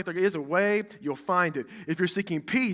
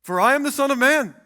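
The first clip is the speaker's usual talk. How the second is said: higher, much louder, indignant mock rebuke; mock angry Jesus; 6 semitones higher, 12 dB louder than usual. Preacher pretending Jesus scolds the disciples, loud indignant rebuke. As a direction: thunder at them like an offended deity. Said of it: "He didn't go".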